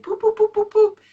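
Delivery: put-on high playful baby-talk poops; silly high voice playing with a baby. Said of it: "someone's like".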